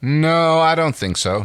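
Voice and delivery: loud, high and defiant; defiant refusal. Acted. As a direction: throw the no back at her. Crichton refusing at gunpoint.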